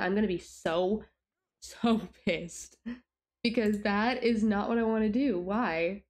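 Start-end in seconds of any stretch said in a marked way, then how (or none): none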